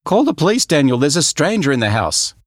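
high, loud panicked call for help; panicked shout. Acted. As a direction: shout in fright. Dad panics at stranger.

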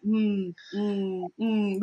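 a mock moaning mm mm mm; imitated kissing moans. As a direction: hum the guy's make-out sounds as a joke. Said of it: "he would make those kind of sounds".

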